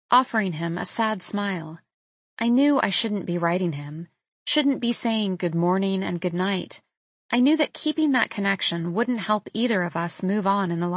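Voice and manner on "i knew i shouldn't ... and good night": plain reading voice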